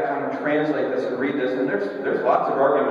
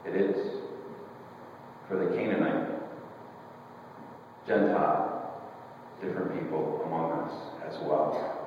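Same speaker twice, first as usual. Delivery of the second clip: soft gentle declaration; 7 semitones lower, 9 dB quieter than usual. Retold or imitated. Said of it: "but Jesus says".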